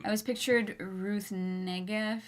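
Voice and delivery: ordinary talk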